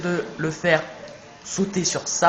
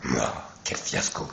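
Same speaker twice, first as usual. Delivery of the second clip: villain voice; 10 dB quieter than usual. Acted. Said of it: "ma grosse voix de méchant". (voix de méchant lente et grave)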